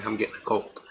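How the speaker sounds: usual speaking voice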